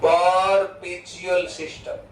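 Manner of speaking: perpetual system said very slowly, syllable by syllable; slow dictation. Shown as a demonstration. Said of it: "એનું બીજું નામ બી છે". (perpetual system ધીમે ધીમે લખાવતા)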